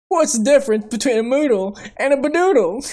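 bouncy goofy joke voice; excited cartoon voice. Reciting cartoon joke quote.

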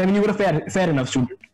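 ordinary delivery

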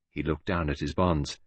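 usual speaking voice

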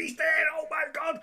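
high, thrilled cry; excited. Retold or imitated. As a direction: gasp it like a horror fan. Horror fan spotting the killer.

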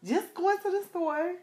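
hammed-up ratchet attitude; ratchet sass. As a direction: really put it on for him. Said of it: "Y'all, I am so ratchet".

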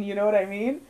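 ordinary talk, nothing marked

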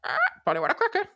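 high squawking parrot. Retold or imitated. Parrot at the window.

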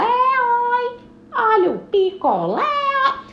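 loud cry, high oi; singsong beach vendor call. Acted. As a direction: keep hawking. Vendor calling out.

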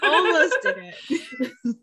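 slow, laughing repeat of the joke; laughing echo. Real, not acted. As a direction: drag it out while laughing.

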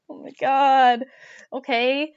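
exasperated disbelief; dismayed. Retold or imitated. Reacting to lost guide.

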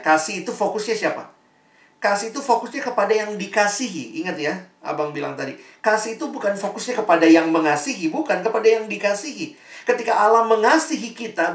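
высокий громкий проповеднический голос; fervent, climbing sermon peak; preaching at full force. Acted. Preaching climax.

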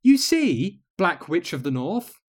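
serene witch voice. Acted. Serene guide teaches queen.

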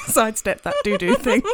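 giggling through the words; laughing. Real, not acted. Laughing at her friend's doo-doo joke. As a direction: laugh while you say it.

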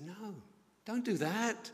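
high, mock pleading hush; placating plea. Uncle begging nephew not to tell Mum, high pleading. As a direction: talk the kid out of telling.

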